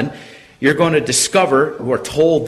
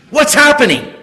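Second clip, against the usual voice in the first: laugh, then a high, loud question; high-pitched, laughing exclamation; amused amazement; 13 semitones higher, 7 dB louder than usual. Real, not acted. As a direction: break into a laugh and ask in raised pitch. Preacher laughs at the surprising count.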